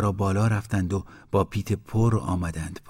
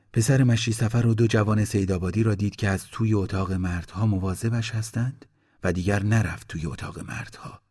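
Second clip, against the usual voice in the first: usual speaking voice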